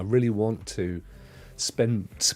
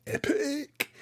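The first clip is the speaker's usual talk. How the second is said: much higher, thrilled "Epic!"; excited exclaim. Real, not acted. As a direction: yelp it out like big news. Reminds viewers about Epic free game.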